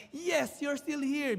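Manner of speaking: put-on boy back at the antique shop, delighted; relieved joy. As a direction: sigh of joy finding it still there. Boy finds boat again.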